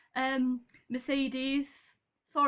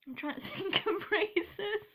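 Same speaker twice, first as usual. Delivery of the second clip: higher-pitched, laughing through the words; 8 semitones higher than usual. Real, not acted. Vlogger stuck choosing a favorite race, laughing. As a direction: giggle while searching for an answer.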